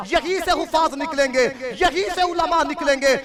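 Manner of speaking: loud, high-pitched sermon delivery; full-force preaching. Real, not acted. Preacher challenging listeners about Saudi Arabia, full-force preaching. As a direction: drive each point home to the congregation.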